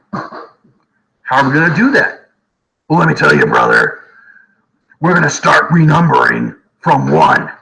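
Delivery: a hammed-up revolutionary pitching the plan; mock revolutionary bro. Mock revolutionary announcing new calendar.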